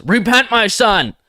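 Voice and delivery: high and loud mock-preachy shout; hammed-up shouting churchgoer; all-caps voice. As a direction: read a caps-lock comment as a booming sermon. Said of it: "That's what I imagine you sound like when you're using all caps".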